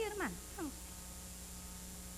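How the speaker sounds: slow, breathy whispered hermana, estamos bien; mock hushed reassurance. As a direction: murmur it like an excuse. Evasive member insisting all is fine.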